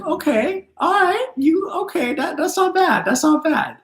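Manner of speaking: very high, squeaky put-on guide voice; cartoonish high spirit-guide voice; guides' voice. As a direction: pitch way up to play the guides approving. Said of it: "my guys are like".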